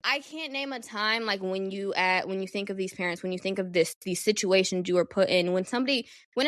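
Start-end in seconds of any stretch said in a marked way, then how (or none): none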